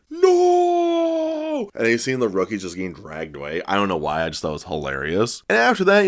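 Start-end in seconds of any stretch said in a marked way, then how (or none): 0.1-1.7 a mock movie-hero cry of despair, much higher and louder; over-the-top dramatic no; hammed-up anguished cry. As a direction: wail it like the hero losing his partner. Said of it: "David's just like".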